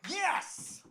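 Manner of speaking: high, whooping stage-entrance cheer; excited yell. Real, not acted. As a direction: holler a triumphant yes to the crowd. Comedian calls out taking the stage.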